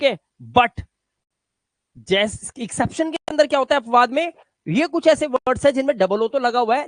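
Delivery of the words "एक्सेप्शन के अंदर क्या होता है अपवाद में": normal talking voice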